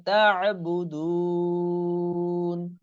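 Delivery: slow melodic tajweed chanting; Quran recitation. Shown as a demonstration. Teacher reciting the surah for students, slow chant. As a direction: draw the vowels out in recitation.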